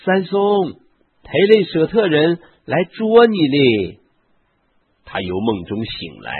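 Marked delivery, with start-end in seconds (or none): 0.0-4.0 high, loud shouted alarm. A narrator's reading. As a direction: call out urgently, pitched well up. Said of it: "他于是喊说". (高声呼喊示警)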